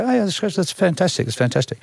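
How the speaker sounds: high-starting, airy mock approval; feigned enthusiasm. Humouring people who hype him up, feigned enthusiasm. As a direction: play along with the hype, falling away. Acting his polite reply to hype.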